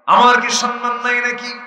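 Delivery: high, loud hurt protest; indignant child's cry. Acted. Hussain demanding respect from the prophet, indignant and loud.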